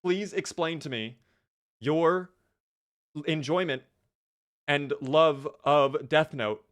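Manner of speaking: halting, word-by-word insistence; exasperated, each phrase set apart; exasperated demand. Real, not acted. Scolding the AI for disobeying.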